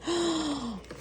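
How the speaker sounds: long, high, soft gasp; delighted gasp. Real, not acted. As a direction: gasp in awe at a treat. Opens more desserts.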